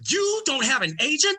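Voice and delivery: high disbelieving squeal; surprised agent. Retold or imitated. Agent amazed the comic has no representation. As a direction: act shocked he has no agent.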